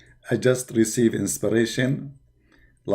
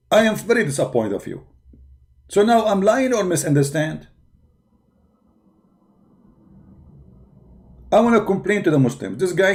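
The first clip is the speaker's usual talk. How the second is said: mock indignation; 6 semitones higher than usual. Mocking indignant complaint to viewers.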